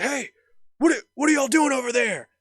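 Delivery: high, loud imitated guard; yelling from afar. Guard challenging two guys from across the lot, retold shout. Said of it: "yelling from across the parking lot".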